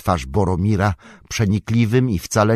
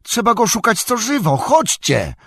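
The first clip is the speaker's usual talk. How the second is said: much higher, urgent hobbit rallying the others; urgent call; 14 semitones higher than usual. Acted. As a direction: hurry them all off to search. Sam urges everyone to search. (naglące wołanie, dużo wyżej)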